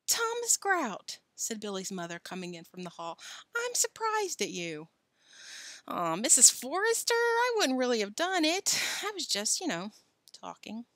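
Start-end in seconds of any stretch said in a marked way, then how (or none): 0.1-1.2 high, slow mother's reproach; scolding mother. A narrator's reading. Billy's mother catching Tom at the pudding, scolding. 3.5-4.9 high, slow reproach; scolding mother. A narrator's reading. Billy's mother coming in from the hall, reproachful. 5.9-10.8 flustered excuse. A narrator's reading. Tom caught, makes excuses.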